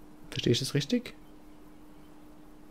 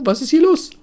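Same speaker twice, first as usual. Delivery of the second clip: surprised; 5 dB louder than usual. Real, not acted. Surprised at the character list.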